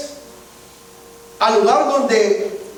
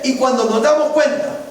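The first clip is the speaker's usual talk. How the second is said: ordinary talk, nothing marked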